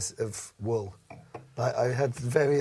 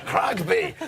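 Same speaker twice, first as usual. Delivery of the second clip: South African; 6 semitones higher, 7 dB louder than usual. Retold or imitated. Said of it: "I went really South African".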